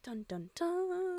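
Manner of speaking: soft, slow whispered sting; whispered dun dun dun. Acted.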